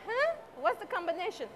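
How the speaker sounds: high-pitched, baffled "huh"; acted puzzlement. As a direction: imitate a confused listener, voice jumping up. Acts out a puzzled listener.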